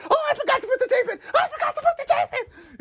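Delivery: high, fast yelling through laughter; panicked wail with a laugh; hammed-up meltdown; mock freak-out. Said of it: "He's like".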